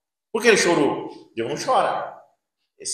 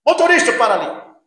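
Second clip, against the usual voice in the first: higher, louder call to the driver; 6 semitones higher, 6 dB louder than usual. Retold or imitated. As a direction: shout a stop request across the bus. Bus passenger calls the driver. (passageiro chama o motorista, alto)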